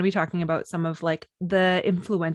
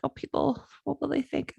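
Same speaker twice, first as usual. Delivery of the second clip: small, high, softer worried voice; mock timid worry, pitched up; worried younger self; 6 semitones higher, 5 dB quieter than usual. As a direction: imitate fretting about others' opinions. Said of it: "I'm scared".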